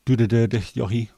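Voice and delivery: Dutch phrase. Shown as a demonstration. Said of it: "weird words that we keep on using".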